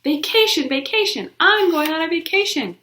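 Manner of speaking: put-on girl shouting news of her vacation to class; shouted. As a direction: fling the news at the whole room. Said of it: "and I shouted".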